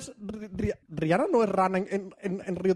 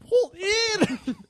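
laughing exclamation; 10 semitones higher than usual. Real, not acted.

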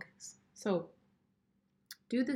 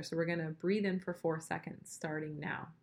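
guided breathing. Shown as a demonstration. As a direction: lead the breath gently. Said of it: "let's just do it once together".

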